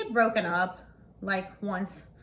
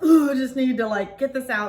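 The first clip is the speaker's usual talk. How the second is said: upset venting; 3 semitones higher, 7 dB louder than usual. Retold or imitated. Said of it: "I call my best friend".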